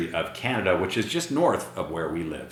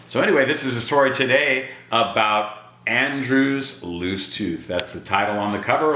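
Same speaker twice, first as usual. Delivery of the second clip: plain reading voice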